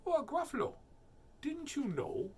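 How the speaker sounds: very high, lilting mouse; mouse character. Acted. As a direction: feign surprise in a tiny mouse voice. Mouse feigns surprise.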